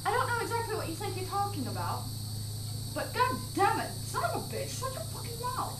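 British vampire character. Acted. Playing a small British vampire from Path of the Vampire, British accent. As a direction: scold in a put-on, high British voice. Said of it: "something my character on Path of the Vampire would say".

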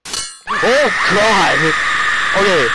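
sudden loud cry of fright; real jump-scare shout; startled shout. Real, not acted. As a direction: yelp as the scare hits.

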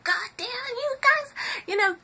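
very high, loud, harsh yelling; mock ferocious mom screaming, fast at the end; horrible ugly scream. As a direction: scare the boys off with an ugly shriek. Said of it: "I made my voice horrible".

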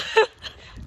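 loud, airy laugh with no voice; breathless laugh. Real, not acted. Runner celebrating a finished kilometer, breathless laugh. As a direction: let a winded laugh burst out.

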